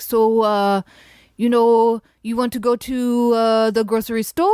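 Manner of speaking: put-on French-accented English with hesitations; mimicked Swiss French accent. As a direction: imitate a man clumsily copying a Swiss French accent. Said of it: "mimicking their speech patterns".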